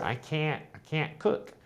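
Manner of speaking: "can't" with a flat high a, softly; American can't cook. Shown as a demonstration. Teacher showing how Americans say "I can't cook". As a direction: keep it up front and nasal-free, American style. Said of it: "it's all up here".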